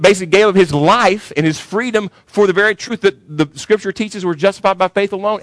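preaching at full force. Acted. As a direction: hammer it home to the congregation. Preaching climax of Tommy story.

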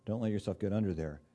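ordinary talk, nothing marked

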